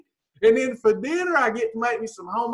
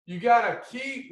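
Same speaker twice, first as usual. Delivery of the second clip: ordinary delivery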